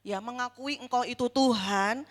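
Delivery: ordinary talk, nothing marked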